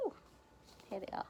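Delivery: usual speaking voice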